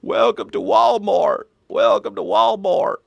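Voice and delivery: slow old-guy Southern politician impression; deliberate, drawn-out denial in a put-on Arkansas voice; Bill Clinton. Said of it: "Bill Clinton impersonation is just an old guy".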